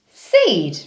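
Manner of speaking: Seed said as one smooth blended word. Shown as a demonstration. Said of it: "blend it".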